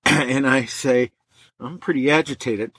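ordinary talk, nothing marked

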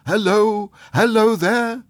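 high, loud hallo; shouting hello to the world; joyful shout. A narrator's reading. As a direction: call out gleefully. Scrooge shouting greetings joyfully.

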